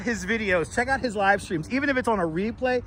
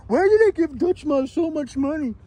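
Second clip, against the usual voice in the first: mock Discord debater; 8 semitones higher than usual. Mocking Discord debaters' voices.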